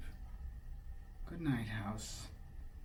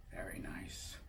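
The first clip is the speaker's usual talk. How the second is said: hushed breathy whisper, much softer; 7 semitones lower, 12 dB quieter than usual. Real, not acted. Parent whispering softly to the drowsy child. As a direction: drop to a whisper, barely there. Parent reading bedtime book.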